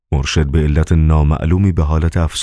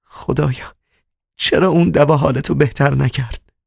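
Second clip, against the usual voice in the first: tearful moan; 9 semitones higher than usual. Acted. Margarita embracing the sick master, tearful moan. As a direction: moan it high, as if crying. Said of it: "گریه کنان نالید".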